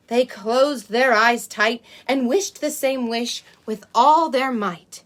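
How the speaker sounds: put-on lively read-aloud for small children; storybook reading. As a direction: read it like a bedtime picture book. Reads a picture book to children.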